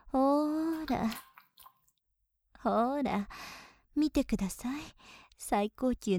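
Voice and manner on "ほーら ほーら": very slow stretched ほーら; drawn-out coaxing. Acted. Maid coaxing her master, slow drawl. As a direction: draw it out slowly.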